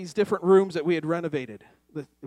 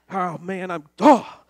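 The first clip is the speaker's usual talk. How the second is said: usual speaking voice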